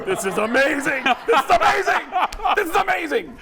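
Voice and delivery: high, loud giddy glee; high excited glee breaking into laughter; gleeful delight. Retold or imitated. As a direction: relish the disaster. Comedians gleeful in the back.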